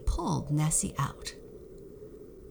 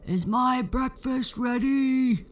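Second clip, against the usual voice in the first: loud, much higher booming giant yell; 9 semitones higher, 5 dB louder than usual. Acted. Giant shouting for breakfast, loud booming yell. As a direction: bellow it down the castle like a huge giant. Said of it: "the giant would yell".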